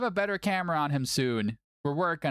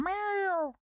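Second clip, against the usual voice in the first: high, squeaky meow; cat meow. Pretend. Streamer meows at his cat Milo, high squeaky meow. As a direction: meow back at the cat like a kitten.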